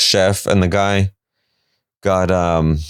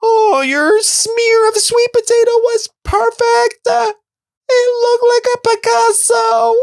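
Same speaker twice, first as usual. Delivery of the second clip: gushing fan. Pretend. Viewer gushes at Jared through the TV, very high mock coo. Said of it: "And I was like".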